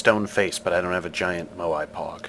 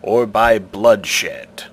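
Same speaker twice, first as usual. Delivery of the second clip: commanding stone guardian, louder; stone face voice; 5 semitones higher, 8 dB louder than usual. Acted. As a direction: demand an answer like an ancient idol.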